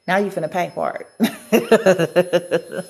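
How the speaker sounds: slow retold warning breaking into laughter; amused mock threat. Voicing guides, breaks into laughing.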